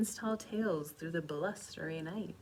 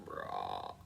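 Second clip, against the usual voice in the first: ordinary delivery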